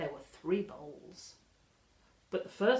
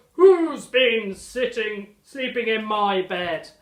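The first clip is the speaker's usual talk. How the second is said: slow, very loud booming bear voice; Daddy Bear bellowing; 2 semitones higher, 14 dB louder, slower than usual. Acted. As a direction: boom it out like a big father bear. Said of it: "he bellowed".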